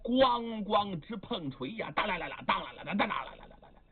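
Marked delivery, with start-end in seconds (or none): 1.9-3.8 fast rattling clang imitation; clanging hammers. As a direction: rattle off the clash of metal quickly.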